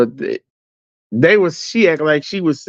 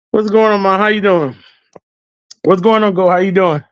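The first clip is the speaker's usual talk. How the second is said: calling out; 6 semitones higher than usual. Real, not acted. Greeting passers-by mid-story.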